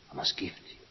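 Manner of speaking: a hushed inner vow; whispered. Retold or imitated. Speaker whispering the man's vow to the woman. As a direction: whisper it, almost breathless.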